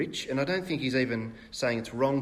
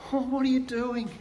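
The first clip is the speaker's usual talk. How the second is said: 1 sympathetic; 11 semitones higher than usual. Shown as a demonstration. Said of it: "kind of sympathetic tone".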